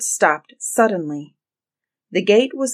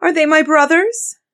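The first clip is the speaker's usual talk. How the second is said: high, raised, eager question; anxious hope; 10 semitones higher, 6 dB louder than usual. A narrator's reading. Wife asking whether her rescuers are coming, anxious hope. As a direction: ask high and loud, hoping for rescue.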